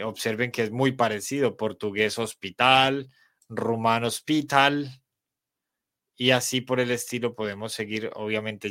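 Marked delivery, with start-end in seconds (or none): none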